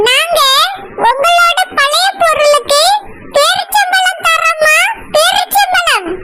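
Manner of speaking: loud drawn-out hawker's call; singsong street hawker. Acted. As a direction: chant it like a street vendor. Calling out wares in street.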